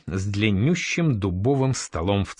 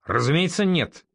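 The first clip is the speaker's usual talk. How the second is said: higher, mildly annoyed denial; irritated; 7 semitones higher than usual. A narrator's reading. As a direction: deny it with a touch of irritation. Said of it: "раздражённо ответил Норман". (слегка раздражённо)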